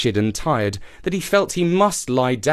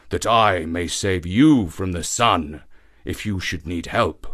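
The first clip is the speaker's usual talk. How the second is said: put-on slow, lower pleading voice of an old lion; 4 semitones lower, slower than usual. A narrator's reading. Trapped old lion begs the youth, slow and pleading. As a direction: beg to be freed, slow and weary.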